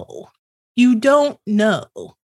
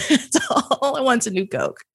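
ordinary talk

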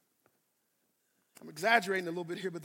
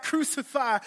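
loud mob cry; shouting crucify; 5 semitones higher, 5 dB louder than usual. Acted. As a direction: call out crucify like the crowd. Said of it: "We shout".

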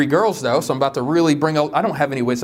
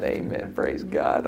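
a flat, softer whispered "amen, praise God"; 14 semitones lower, 7 dB quieter, slower than usual. Pretend. Answering for a silent congregation, whispered mock amen. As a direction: breathe it like a lone joke response.

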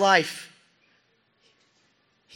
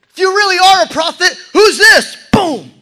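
much louder, higher, faster jeer; shouted taunt; 14 semitones higher, 16 dB louder than usual. Acted. Soldiers scream mockery at the prisoner, shouting. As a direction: yell it right in his face.

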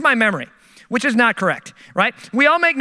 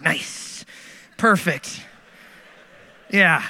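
dry sarcasm; 5 semitones lower, much slower than usual. Retold or imitated. Sarcastic self-commentary.

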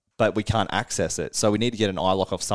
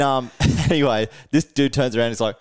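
ordinary delivery

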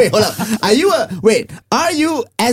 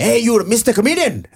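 higher-pitched Eurasian uncle hailing someone; Eurasian uncle accent; 5 semitones higher than usual. Retold or imitated. Uncle comes up to a comedian, in a Eurasian uncle accent. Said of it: "That is a Eurasian uncle accent".

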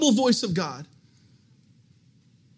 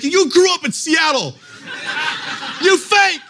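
much higher, louder jeering laugh; higher, louder laughing taunt; mocking heckle; 7 semitones higher, 6 dB louder than usual. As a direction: call out the fake preacher. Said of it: "I'm like".

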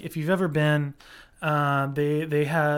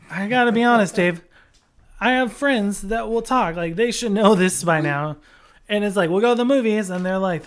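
normal talking voice

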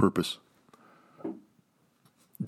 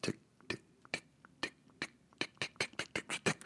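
whispered ticking; 15 dB quieter than usual. Pretend. Imitating a clock in his mind.

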